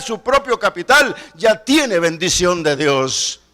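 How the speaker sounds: loud, driving preacher voice; full-force preaching. Acted. Preacher urging the church to tithe, loud preaching. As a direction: preach it to the congregation.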